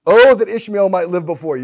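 high, loud, wheedling correction of God; coaxing Abraham. Retold or imitated. Abraham correcting God, high loud coaxing tone. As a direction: talk God round, like He slipped up. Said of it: "He says".

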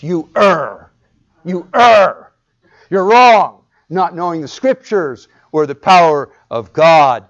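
loud, building scolding rebuke; indignant rebuke. Acted. Preacher voicing Jesus rebuking the Pharisees, loud indignant scolding. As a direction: scold them with rising force.

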